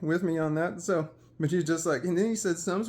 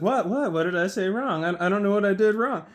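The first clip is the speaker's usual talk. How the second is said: loud, higher bewildered self-defence; mock confused protest; 6 semitones higher, 7 dB louder than usual. Puffed-up buddy asking what he did wrong, mock confusion. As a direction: imitate a baffled sidekick.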